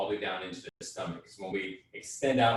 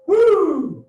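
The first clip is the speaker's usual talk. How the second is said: whooping cry; 13 dB louder than usual. Real, not acted. End of power-up drill.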